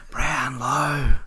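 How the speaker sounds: hammed-up loud repeat; mock stage whisper turned loud. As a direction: blurt the secret out loud, playfully. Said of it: "he whispers to him".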